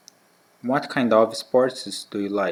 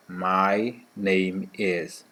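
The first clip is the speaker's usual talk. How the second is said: very slow repeat; much slower than usual. Shown as a demonstration. Repeated very slowly.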